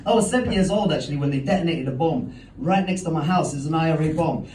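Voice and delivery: usual speaking voice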